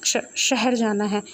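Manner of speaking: slow, halting, scared stammer; fearful stammer. Acted. Wangji answers the menacing conductor, stammering in fear. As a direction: stumble over the first word in fright. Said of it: "डरते-डरते आवाज़ में बोला".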